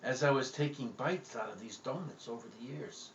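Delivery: ordinary talk, nothing marked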